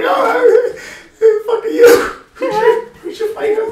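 high, laughing gushing greeting; fawning check-in guy. Retold or imitated. Check-in guy gushing at Aaron Pico, high and laughing. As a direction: mimic the check-in guy fawning over a star. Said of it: "He's like".

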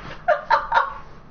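high, voiceless giggle; real helpless laugh; breaking laughter. Real, not acted. Gamer laughing at own bad landing, helpless giggle. Laughs after failing in game.

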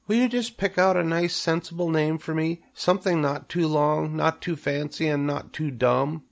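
high, small requesting voice; third little spider. A narrator's reading. Third spider requests a name, high little voice. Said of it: "said the third spider".

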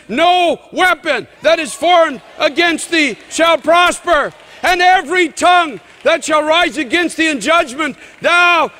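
high, loud declamation building to a peak; full-force preaching. Real, not acted. Preacher proclaiming no weapon shall prosper, full-force preaching. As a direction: hammer out each promise with ringing force.

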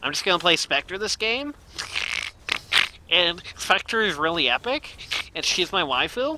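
lightly voiced excited anime fan; a hint of a gushing streamer; Debush voice. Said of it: "that's my Debush impression".

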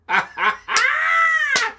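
high loud cackle; gleeful cackle. Acted. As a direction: cackle at the plan. Gleeful idea.

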